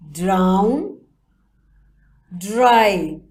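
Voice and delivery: each word said loud and clear, one by one; teacher modelling words. Shown as a demonstration. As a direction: call out each word for the class to repeat. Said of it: "repeat these words after me".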